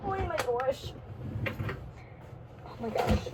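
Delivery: ordinary delivery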